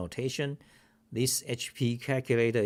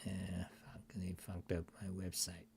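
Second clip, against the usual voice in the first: whispered, frustrated swearing under the breath; a fed-up aside, half whispered; muttered curse; 12 dB quieter than usual. Real, not acted. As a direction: hiss the complaint to yourself, quick. Frustrated at his broken website.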